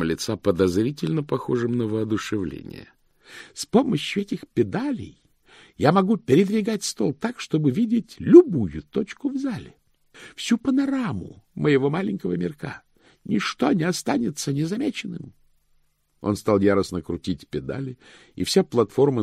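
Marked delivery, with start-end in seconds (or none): 3.5-15.3 high-voiced, enthusiastic pedant; high, excited clerk showing off; animated chief cashier. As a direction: proudly demonstrate a pet invention, pitch raised.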